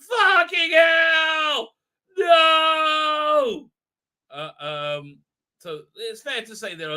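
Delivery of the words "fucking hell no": slow, much higher, louder terrified yell; mock scream. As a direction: yell it out in fake terror. Heroine screams at the statue.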